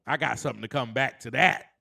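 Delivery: ordinary delivery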